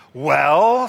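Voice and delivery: snarled. A narrator's reading. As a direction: bite out the word. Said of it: "snarled the wolf".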